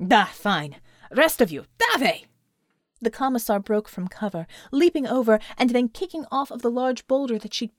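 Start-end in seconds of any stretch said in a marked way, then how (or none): 0.1-2.3 loud order rising to a shout; brisk battle command. A narrator's reading. Commander sends remaining comrades into the charge, loud command. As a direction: accept it, then yell the rest forward.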